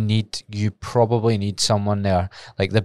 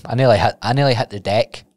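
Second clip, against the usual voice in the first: ordinary delivery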